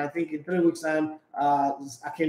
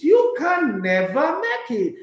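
slow, higher repeat of the verdict; mock scolding; 9 semitones higher, 4 dB louder, slower than usual. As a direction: drag out each word in scorn. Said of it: "he says, he says".

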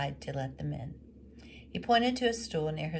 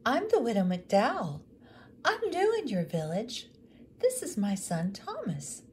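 higher, a touch louder, polite newcomer; widow's voice; 6 semitones higher, 5 dB louder than usual. Acted. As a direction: introduce yourself as the new widow in the village. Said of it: "the woman said".

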